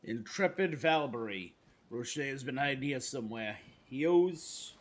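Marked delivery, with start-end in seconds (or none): 3.8-4.7 put-on Brooklyn accent. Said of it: "I always default to the, uh, the Brooklyn accent".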